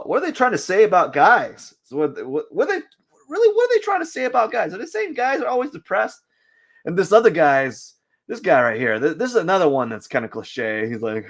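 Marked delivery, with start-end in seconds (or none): 2.5-6.2 high, fast incredulous rant. Real, not acted. Ranting at the art sites about depressed male poses, in disbelief. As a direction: ask it in disbelief.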